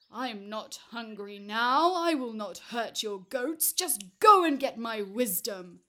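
very loud, booming giant's order; impatient giant. Acted. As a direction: bellow it, out of patience.

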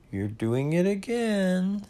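mildly sad, high and slow. A narrator's reading. Pooh complaining to Owl, a touch sad. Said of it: "said Pooh sadly".

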